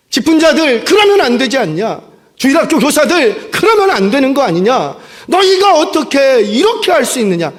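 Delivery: loud, high-pitched scolding; acted rebuke at full volume; reproaching. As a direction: call them out and chew them out. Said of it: "그렇게 예수님이 이 제자들을 책망하고 계시는".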